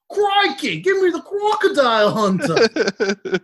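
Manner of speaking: high, slow Australian croc-hunter voice; Australian accent, excited wildlife host; Steve Irwin. Retold or imitated. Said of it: "it does not have to be Steve Irwin".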